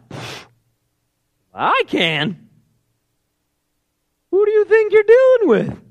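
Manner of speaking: scoff, then indignant high, loud outburst; mock offended bragging, climbing to a high pitch; with an attitude. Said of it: "like, an attitude".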